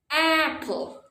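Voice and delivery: APPLE with a high, long AP, falling away; stress on first syllable. Shown as a demonstration. Teacher demonstrating word stress, AP punched in apple. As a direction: lean hard on the AP of apple. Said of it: "the stressed syllable, is the first syllable".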